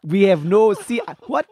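put-on indignant comic Nigerian accent; Nigerian prince accent. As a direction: protest in a mock Nigerian accent. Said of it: "Do a, do the Nigerian".

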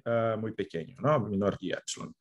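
normal talking voice

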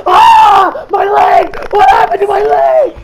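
very high, very loud hammed-up wail; mock agonized scream. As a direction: scream in exaggerated pain. Acts out character's agony.

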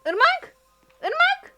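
very high, loud panicked shout; frantic calling. Real, not acted. Player left alone shrieking for her friend, panicked yell. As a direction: scream the name across the map.

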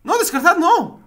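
high, loud panicked yell; panicked shout. Real, not acted. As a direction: yell out in alarm. Panicked at game.

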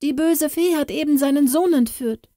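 put-on high, urgent girl's voice; urgent cry. Katrin bursting into the kitchen to warn the cook, urgent high cry. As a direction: rush to bring the bad news. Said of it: "rief sie".

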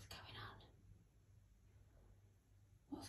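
hushed secret whisper. Real, not acted. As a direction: lean in and whisper it. Whispering to the cat.

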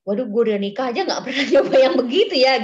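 shocked, giggling confession; amazed laughing aside. Retold or imitated. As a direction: blurt it out, half laughing in disbelief. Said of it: "Saya yang dengernya gini".

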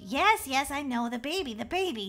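normal talking voice

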